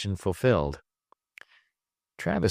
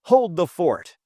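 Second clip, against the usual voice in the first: louder, higher shout over the shoulder; bawled; 9 semitones higher, 5 dB louder than usual. Acted. Travis calls to Trip leaving the room, bawling. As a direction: call back while rushing out. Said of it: "he bawled over his shoulder".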